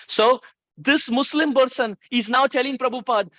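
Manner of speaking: ordinary talk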